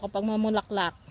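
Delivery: ordinary delivery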